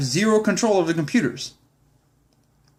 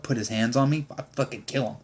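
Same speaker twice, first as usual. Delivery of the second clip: normal talking voice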